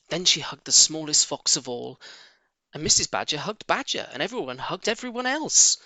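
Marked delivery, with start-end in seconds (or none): none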